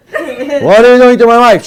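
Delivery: hammed-up jealous husband; mock angry husband. Angry husband confronting the rabbi, mock loud demand.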